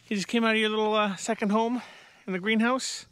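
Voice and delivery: high, gentle pet talk; cooing to the cat. Real, not acted. Owner greeting his cat in a high, cooing voice.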